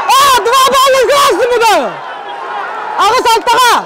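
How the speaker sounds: high-pitched, shouted match call; ecstatic play-by-play. Real, not acted. Commentator as Konstantin Kapırnov reaches the final, ecstatic play-by-play. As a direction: build to long, drawn-out victory cries. Commentator calling the decisive winning points.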